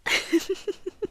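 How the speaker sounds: soft high breathy laugh; a tiny teasing giggle; hushed amused laugh. Acted. Amused at listener's discomfort.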